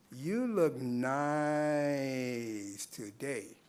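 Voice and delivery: very slow, with NICE stretched right out; long, lingering, insinuating compliment; suggestive leering. Shown as a demonstration. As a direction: leer it, as if looking someone up and down. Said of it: "we have you looking the person up and down".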